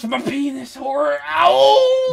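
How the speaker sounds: high, slow hammy wailing; mock pained wail. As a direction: wail like a wounded cowboy. Mock pain, cry heard.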